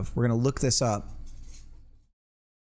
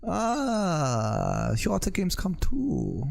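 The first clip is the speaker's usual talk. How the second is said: higher-pitched mock German accent; movie German accent; 5 semitones higher, much slower than usual. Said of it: "That's my movie German accent".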